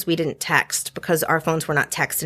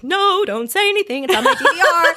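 imitating friend Tina in a much higher, put-on voice; mock Tina voice; 11 semitones higher, 4 dB louder than usual. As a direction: pitch it way up to play Tina begging for no spoilers. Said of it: "And Tina would be like".

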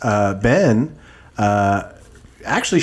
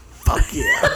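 squealed high excited yes through a laugh; a gleeful shriek of yes; mock eager yes. Giddy high shriek as the eager partner.

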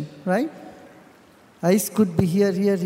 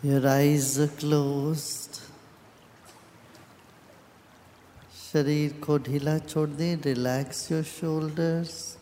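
guided meditation; 4 semitones lower than usual. Real, not acted. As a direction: guide them inward, calm and unhurried, with long pauses.

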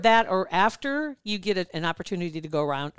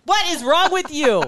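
louder, higher, slow exasperated outburst; mock outrage; 8 semitones higher, 6 dB louder than usual. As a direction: scold the texting driver. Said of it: "I'm just like".

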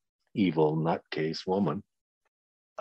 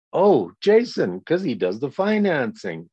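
mock quiz answer; 6 semitones higher, 4 dB louder than usual.

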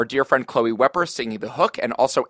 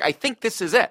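lightly voiced eager conviction, higher; excited; 10 semitones higher than usual. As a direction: pitch up with eager certainty. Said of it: "And Larry was like".

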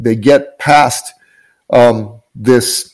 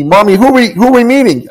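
lightly voiced small child, higher and curious; little boy's voice; 10 semitones higher than usual. Mother retelling her son's eager question, a child's lifted voice. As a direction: ask it like an eager little boy. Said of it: "my son asked me".

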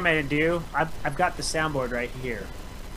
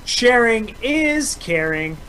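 high, slow singsong; goofy singsong catchphrase; silly clown; 8 semitones higher, 4 dB louder, much slower than usual. Pretend.

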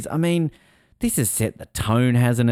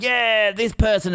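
high, slow, drawn-out mock cheer; hammed-up crowd hurrah; 11 semitones higher, slower than usual. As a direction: cheer each name like a bored crowd.

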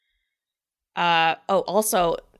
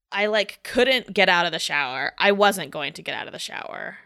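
ordinary talk, nothing marked

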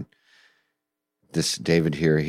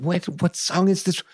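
high, excited disbelief; amazed questions. Retold or imitated. Friend hearing Fenario for the first time, excited disbelief. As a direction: burst out asking in wonder. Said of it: "Peter going".

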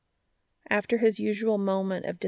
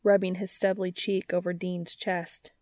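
ordinary delivery, nothing marked

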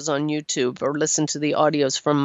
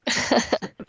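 airy, higher chuckle; real short laugh; 6 semitones higher than usual. Real, not acted. Host at caller's curtain joke, short chuckle. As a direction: give a brief amused laugh.

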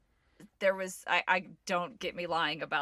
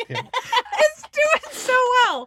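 laughing; 11 dB louder, much slower than usual. Real, not acted. Laughing at cohost's voice.

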